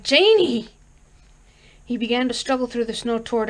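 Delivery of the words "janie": much higher, louder cry of her name; desperate cry. A narrator's reading. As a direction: cry out with everything he has. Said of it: "Lumley cried".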